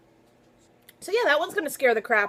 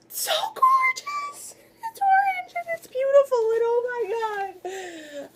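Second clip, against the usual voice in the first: ecstatic squeal; 14 semitones higher than usual. Real, not acted. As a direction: melt over the gorgeous cover. Gushing over a hardcover.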